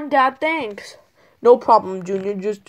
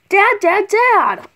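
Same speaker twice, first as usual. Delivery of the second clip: loud high calls for dad; calling out; 9 semitones higher, 4 dB louder, slower than usual. Acted. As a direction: call out for Dad loudly.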